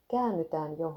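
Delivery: ordinary delivery, nothing marked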